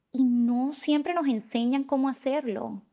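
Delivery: ordinary talk, nothing marked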